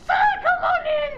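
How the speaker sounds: high, hearty shouted welcome; calling out. Acted. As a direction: call a customer in from across the room. Counterman calls customer in.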